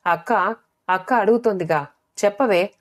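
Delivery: usual speaking voice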